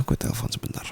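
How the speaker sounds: quick hushed whisper; soft whispered excuse; whispered aside. Acted. Aside before a phone call.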